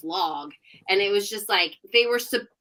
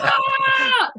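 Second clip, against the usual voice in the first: high, loud elated scream; joyful scream; 7 dB louder than usual. Retold or imitated. Photographer streaking past the camera, screaming with joy. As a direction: let out a wild victory scream. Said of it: "you can hear him screaming".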